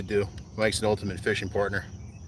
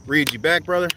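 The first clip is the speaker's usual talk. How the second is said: loud, high friendly holler; raised voice carrying to a friend; calling out; 7 semitones higher, 7 dB louder than usual. Real, not acted. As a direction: shout a question over to your buddy.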